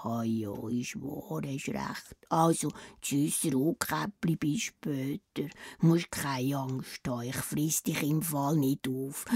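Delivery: deep, slow, soft wolf voice; soft low wolf. Acted. The wolf saying goodbye to Rotkäppli sweetly. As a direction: purr it low and gentle like a tame wolf.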